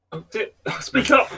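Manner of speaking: high-pitched, laughing plea; laughing call. Real, not acted. Urging quiet friend to speak up.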